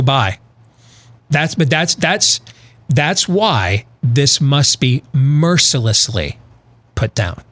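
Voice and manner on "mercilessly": "mercilessly" drawn out slowly, syllable by syllable; stress on mercilessly. Real, not acted. Host demanding the riots be put down, stressing "mercilessly". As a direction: lean hard and slow on "mercilessly". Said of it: "Emphasis on mercilessly".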